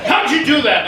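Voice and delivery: loud, high-pitched outraged question; angry demand. Retold or imitated. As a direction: imitate an incensed man demanding answers. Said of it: "why so angry".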